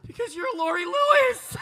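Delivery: shrieking punchline; starstruck shout. Retold or imitated. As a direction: belt it out like an obsessed fan.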